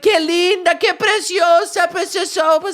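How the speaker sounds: high, loud, drawn-out cooing in Spanish; gushing Spanish admirers. Retold or imitated. Spanish strangers fawning over the baby, loud high-pitched gushing. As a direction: gush over a baby at full volume, very high.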